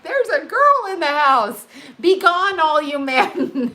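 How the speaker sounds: put-on high, loud playful proclamation of a girl arriving; mock announcement. Mother announcing a girl in the house, high and loud. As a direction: crow it out like a town crier. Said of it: "I'm like".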